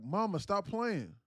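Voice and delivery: loud, high, drawn-out plea; imitated exasperated call. Son to unresponsive mother, exasperated call. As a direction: stretch it out, calling mom's bluff.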